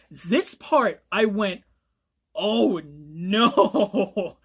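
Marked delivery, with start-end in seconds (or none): 2.3-4.4 loud, drawn-out "no" of dread; long, loud groan of dismay; dismayed reaction. Retold or imitated. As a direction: reenact watching disaster unfold. Reenacts own dismayed reaction while reading.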